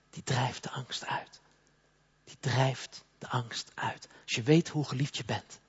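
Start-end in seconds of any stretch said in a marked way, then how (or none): 0.1-4.1 slow breathy whisper: "Die drijft de angst uit", "drijft" still leaned on. Shown as a demonstration. Preacher whispering to the congregation that love drives out fear.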